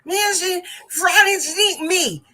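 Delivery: high, loud garbled speech; frustrated student imitation. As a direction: imitate the same unclear, insistent yell. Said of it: "she says the same exact thing".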